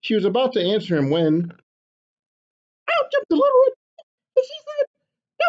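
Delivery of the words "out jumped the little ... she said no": a tiny excited hen butting in; little chicken voice. Acted. Chicken bursting into papa's story, high and eager.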